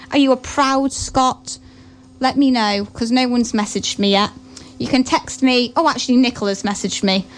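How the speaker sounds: ordinary talk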